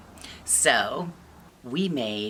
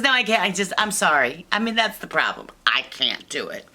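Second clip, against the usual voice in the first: panicked backing out; 3 semitones higher, 6 dB louder than usual. Acted. Shy Sam turns back from the kids, panicked refusal. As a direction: blurt out a flustered apology.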